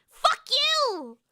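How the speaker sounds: high, drawn-out exasperated cry; sudden high-pitched outburst; frustrated yell. Real, not acted. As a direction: burst out in frustration. Frustrated at failed accent.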